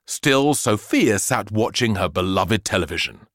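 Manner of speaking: plain reading voice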